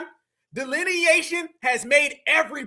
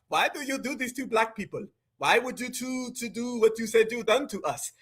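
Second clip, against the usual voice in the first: lightly imitated Caribbean accent, softer; mock Caribbean accent; 4 semitones lower, 6 dB quieter than usual. Host mimics the man he says isn't one of us, mock Caribbean accent. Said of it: "I'm getting a real Caribbean vibe".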